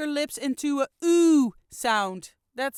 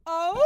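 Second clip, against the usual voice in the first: rising high howl; wolf howl; 6 semitones higher, 5 dB louder than usual. Shown as a demonstration. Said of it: "we're gonna go up and up".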